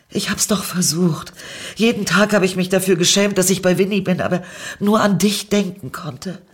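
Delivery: hohe, drängende Stimme, immer eindringlicher; aufgewühlte Liebeserklärung. A narrator's reading. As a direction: ihr atemlos das Herz ausschütten. Tränenreiche Liebeserklärung an Niki. (flehend und aufgewühlt, deutlich höher)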